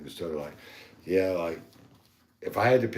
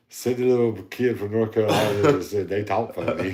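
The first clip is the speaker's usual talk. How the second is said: louder, higher, laughing through it; laughing retort; 4 semitones higher, 7 dB louder than usual. Real, not acted. Talking to a kid from North Carolina, laughing jab. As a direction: tease him while cracking up. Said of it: "mocking the kid while laughing".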